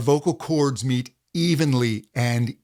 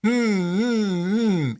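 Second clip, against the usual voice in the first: higher, buzzy hum; resonant hum; 6 semitones higher, much slower than usual. Shown as a demonstration. Teacher showing students the right buzzing hum. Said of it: "It's right there".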